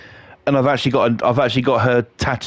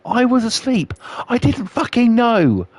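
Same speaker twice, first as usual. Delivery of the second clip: indignant protest; 10 semitones higher than usual. Real, not acted. Protesting his innocence.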